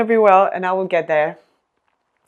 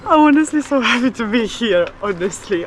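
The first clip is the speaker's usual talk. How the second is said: higher, louder burst of happiness; higher, bubbling happiness; joyful; 4 semitones higher, 4 dB louder than usual. Real, not acted. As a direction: gush with joy. Overjoyed in the garden.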